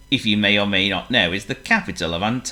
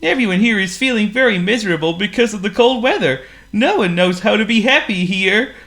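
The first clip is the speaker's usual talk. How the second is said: very high, odd penguin voice, a little glum; 12 semitones higher, 5 dB louder than usual. Acted. Penguin tells the snowman the town is gloomy, in a high penguin voice.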